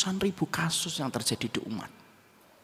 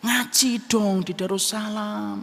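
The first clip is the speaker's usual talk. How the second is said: higher, louder, slow preachy suggestion; mock preachy advice; 8 semitones higher, 6 dB louder, slower than usual. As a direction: drag it out like a pushy preacher. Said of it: "Enggak lah".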